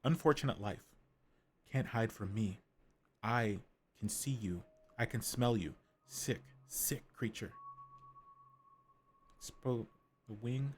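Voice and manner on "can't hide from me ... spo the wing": stalk the prey in a hushed, sinister voice; creepy creature voice. Acted. Creature voice hunting the chicken.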